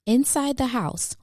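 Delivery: ordinary delivery, nothing marked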